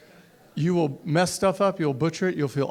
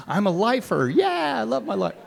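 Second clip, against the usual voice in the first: proud excitement; 6 semitones higher than usual. Retold or imitated. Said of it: "riding home".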